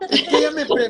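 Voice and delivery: laughing nervously mid-sentence; nervous giggle while speaking. Real, not acted. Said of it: "prefiero reírme".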